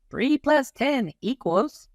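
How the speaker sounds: high, slow teacher prompting the class; cartoon teacher voice. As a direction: ask the sum like a cheery teacher.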